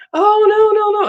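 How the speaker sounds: high, loud nurse brushing it off; mock dismissive nurse. Nurse voiced in retold exchange.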